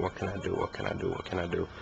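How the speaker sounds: low-voiced, repeated self-questioning; thinking frantically to oneself. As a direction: retell racing thoughts under your breath. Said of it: "I started thinking".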